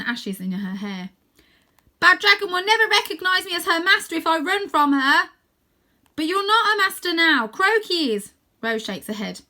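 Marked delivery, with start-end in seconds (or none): 2.0-5.3 high, loud determined call; defiant shout. Acted. Rose declares her resolve. 6.2-8.3 high, loud protest; boy shouting his objection to Rose; arguing shout. Acted. Boy argues back.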